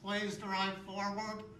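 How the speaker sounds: slow, loud drive-through intercom voice; drive-through speaker voice. Acted. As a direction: read it out like a tinny speaker box. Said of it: "you know what they'll say?".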